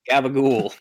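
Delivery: loud, high mob-style gabagool; New Jersey Italian accent. Pretend. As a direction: say it like a Jersey mobster. Mock Italian food word.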